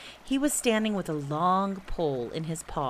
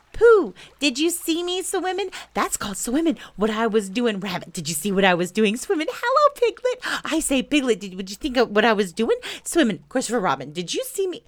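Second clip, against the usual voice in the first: put-on high, loud childish babble; excited squeaking; 5 semitones higher, 6 dB louder than usual. A narrator's reading. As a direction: pester everyone, bursting with pride. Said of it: "squeaked Roo excitedly".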